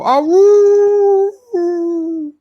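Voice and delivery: long, high, loud dog howl; beagle howl. Retold or imitated. Host mimics Sassy howling in the background, drawn-out beagle howl. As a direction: howl like a beagle, holding one high note. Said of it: "Sassy will go".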